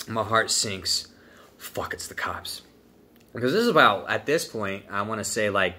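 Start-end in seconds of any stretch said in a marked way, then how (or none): 1.6-2.6 hushed, dread-filled whisper; quiet inner panic at the door; scared whisper. Retold or imitated. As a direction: breathe it out in a panicked hush. Panic thought on knock at door.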